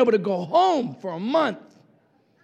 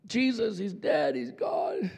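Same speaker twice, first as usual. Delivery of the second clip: softer, hushed grieving report; mourners' words; 8 dB quieter than usual. Retold or imitated. Mourners quietly telling Jesus that Lazarus died. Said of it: "they're like".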